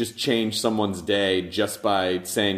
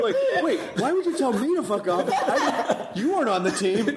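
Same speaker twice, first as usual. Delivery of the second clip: mock indignation; 12 semitones higher than usual. Joking reaction to being told off.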